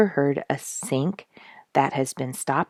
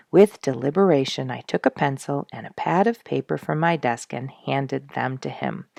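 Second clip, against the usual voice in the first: usual speaking voice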